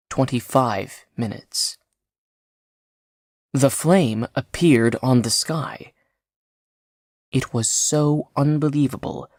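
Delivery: usual speaking voice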